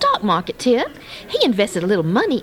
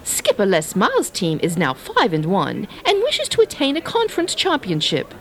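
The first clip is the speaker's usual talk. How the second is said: put-on British accent, pitched lower; 3 semitones lower than usual. [name] previewing LSU and Florida for the coach, put-on British accent. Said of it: "I'll use my British accent".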